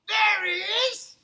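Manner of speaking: loud, slow, high call of recognition. Acted. Beefeater spotting the bear, loud slow call. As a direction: Beefeater points him out across the tea house. Beefeater spots Paddington, calling out.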